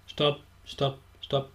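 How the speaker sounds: soft, mumbled, annoyed "stopp, stopp, stopp"; whiny mumbled stop. Retold or imitated. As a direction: brush it off quietly. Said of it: "das mag niemand so hören". (genervt und leise gemurmelt)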